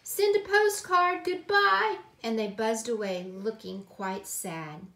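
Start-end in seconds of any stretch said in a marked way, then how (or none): 0.0-2.0 high, loud farewell cry; calling out. A narrator's reading. Aunt and uncle waving goodbye, high loud call. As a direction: call goodbye across a distance. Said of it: "They waved and they cried".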